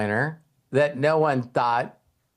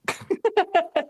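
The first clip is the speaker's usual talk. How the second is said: short real laugh, high up; high amused laugh. Real, not acted. Speaker laughs, high, at the player's long odds.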